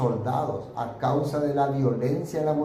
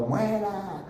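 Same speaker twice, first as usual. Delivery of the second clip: retold crowd yell; 7 semitones higher, much slower than usual. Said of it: "venía detrás gritando".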